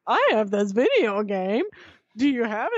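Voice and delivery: lightly mocking cracked teen voice; breaking adolescent voice. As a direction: imitate a boy whose voice is breaking. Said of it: "his voice is, like, breaking like he's fourteen".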